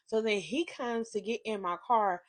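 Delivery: normal talking voice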